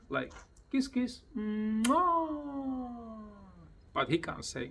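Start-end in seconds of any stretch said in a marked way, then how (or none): 0.7-2.9 high, drawn-out kiss kiss mwah; high, sing-song mwah; high kissy call. Shown as a demonstration. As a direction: blow a kiss to the bird. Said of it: "I teach him like that".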